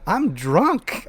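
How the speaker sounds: put-on high, swooping drunken confession; drunk commander. Commander confessing to the gate guard, drunk. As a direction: imitate a merry drunk owning up. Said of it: "my commander's like".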